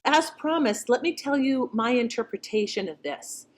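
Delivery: usual speaking voice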